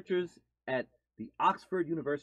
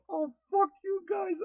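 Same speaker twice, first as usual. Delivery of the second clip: mock yell, much higher pitch; imitated outburst. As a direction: imitate an angry ad character blurting out. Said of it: "the random".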